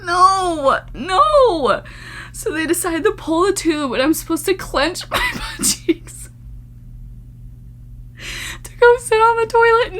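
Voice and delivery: high voice half crying with laughter; laughing horror. Real, not acted. Reacting to the clinic story in high laughing horror. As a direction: squeal through the words.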